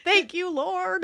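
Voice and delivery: high, slow, laughing thanks; laughing relief. Retold or imitated. Rider relieved at being on the list, laughing thanks. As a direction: drawl a laughing prayer of relief.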